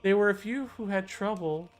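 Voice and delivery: put-on high old lady voice; a dreamy elderly noblewoman. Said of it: "my old lady voice".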